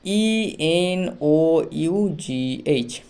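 ই এন ও ইউ জি এইচ spelled very slowly, letter by letter; slow letter spelling. Shown as a demonstration. Teacher spelling out ENOUGH for students, very slowly. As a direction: drag out each letter so students can follow. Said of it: "বানান কি দেওয়া আছে?".

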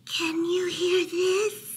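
high, slow, muffled mouse voice; mouse mumbling into pillow. A narrator's reading. Mouse testing Bear's ears from a pillow, high muffled mumble. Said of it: "Mouse mumbled into the pillow".

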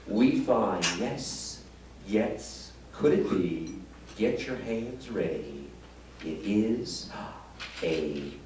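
hushed, slow showman build-up to the reveal; mock magician patter. Magician to audience, hushed mock-grand patter. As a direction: ham up the hushed magic-act announcer.

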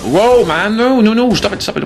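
loud, very high-pitched panic; alarmed yelp. Real, not acted. Player startled by an attacker in the game, panicked shouting.

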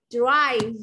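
teacher shows drive, not driver, to the class; correct form. Shown as a demonstration. Teacher models drive without the final er. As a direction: drive with no extra syllable at the end. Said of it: "không gọi là driver".

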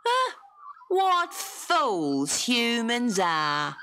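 high, slow, scornful character voice; rude voice. Acted. A stranger mocking Dot by the kangaroo, rude. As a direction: sneer at the foolish girl. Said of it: "she heard a rude voice".

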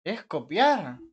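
sarcastic jab. Real, not acted. Sarcasmo sobre copiar.

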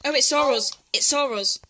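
ordinary talk, nothing marked

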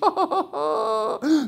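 high, drawn-out mock laugh; gleeful laugh. Homeowner delighted at being independent, hammed-up laughing.

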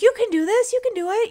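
very high, loud cheerleading; imitated peppy encouragement. Scanner staff egging on a nervous patient, mock cheery. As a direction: cheer them on in a squeaky sing-song.

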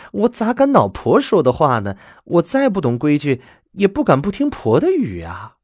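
lightly voiced dutiful daughter-in-law; a hint of a flustered young wife; eager young wife. A narrator's reading. Daughter-in-law protests obedience.

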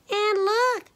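very high little bear squeal; high-pitched baby bear voice; Little Honey. A narrator's reading. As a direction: squeal it as a tiny bear cub. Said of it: "squealed little Honey in his little bear voice".

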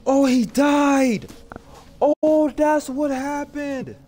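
loud, slow, stunned; dawning horror, drawn out; shocked realization. Real, not acted. Realizes character died.

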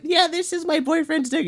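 very high put-on girl voice; mock girl voice. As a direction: mimic her in a squeaky-high pitch. Voicing the girl showing her drawing.

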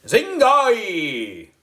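high, drawn-out magic word called loud; wizard voice. A narrator's reading. Boy wizard calling out the magic word, high loud wizard call. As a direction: intone it like a spell. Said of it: "In my best wizard voice, I called out".

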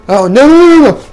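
high, loud frightened pleading; scared no no no. Real, not acted. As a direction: panic as the jump goes wrong. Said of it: "this is scaring me to death".

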